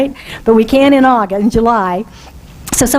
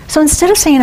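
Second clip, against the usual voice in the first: usual speaking voice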